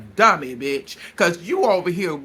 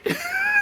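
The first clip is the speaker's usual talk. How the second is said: high laugh. Real, not acted. Laughs at own quote.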